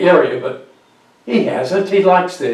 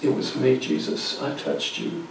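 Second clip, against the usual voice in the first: trembling, hushed confession in a whisper; frightened woman whispering to Jesus; afraid whisper; 8 dB quieter than usual. Acted. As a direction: confess it barely aloud, scared. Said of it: "she's trembling, she's afraid".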